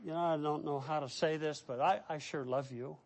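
soft, halting prayer of a new believer; shy heartfelt prayer; humble simple prayer. Shown as a demonstration. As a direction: pray quietly and plainly, unsure of the words. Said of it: "Humbly talk to God".

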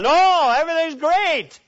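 hammed-up cheerful denial; forced cheer. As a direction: brush it off with a bright fake smile. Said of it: "mask of spirituality".

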